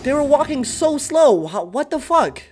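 ordinary talk, nothing marked

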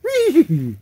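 gleeful squeal. Real, not acted. Celebrating a chase pull.

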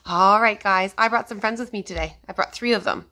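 ordinary talk

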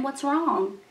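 plain reading voice

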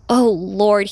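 ordinary delivery, nothing marked

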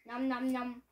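munching noises. Acted. Eating noises in cartoon.

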